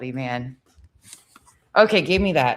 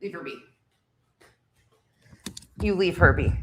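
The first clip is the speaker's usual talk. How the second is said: ordinary delivery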